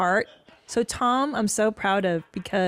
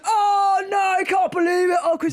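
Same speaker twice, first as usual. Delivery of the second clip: high, loud hammed-up British shout; mock lads' outrage; 12 semitones higher, 5 dB louder than usual. Wife imitating British lads shouting in outrage at the jokes. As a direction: cry out like scandalised fans. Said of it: "the guys were like".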